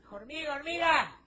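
high, loud call to the ant; high-pitched yell for attention. Acted. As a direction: shout after her so she stops. Donkey calls to passing ant.

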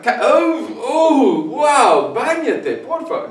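high, hammed-up horror at a stink; mock disgust. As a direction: wail in mock disgust, drawing out the words. Mock disgust at smelly cameraman.

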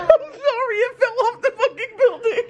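high, laughing apology. Real, not acted. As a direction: squeal it out laughing.

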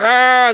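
loud, high "aah" outburst; mock a fired-up explosion, high and loud; fired-up yell. Acting out getting fired up.

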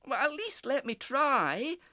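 high, slow, loud plea; begging little breeze. A narrator's reading. Breeze begs the cockerel for a chance, pleading. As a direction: plead earnestly in a small character's voice. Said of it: "begged the little breeze".

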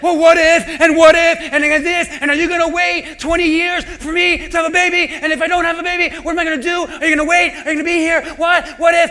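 loud, rapid panicky rambling; anxious overthinker. Retold or imitated. Congregant overthinking out loud, loud and panicky. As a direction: spiral into frantic what-ifs. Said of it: "Some of you are like".

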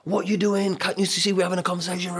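high, loud, hurried telling-off; angry parent scolding. Retold or imitated. Parent to a kid who knocked the cup over, angry scolding. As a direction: snap at the child for interrupting.

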